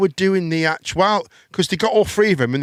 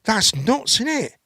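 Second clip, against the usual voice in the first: higher, slow shocked outburst; mock astonishment; 6 semitones higher, slower than usual. Retelling his reaction to a Big Brother clip, mock shock. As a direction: play your own stunned reaction. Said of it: "And I'm like".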